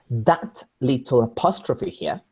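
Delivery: ordinary delivery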